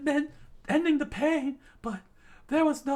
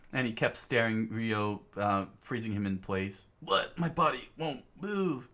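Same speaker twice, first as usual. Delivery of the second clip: story narration; 8 dB quieter than usual. A narrator's reading. Narrator describing the tearful classroom, low hushed storytelling.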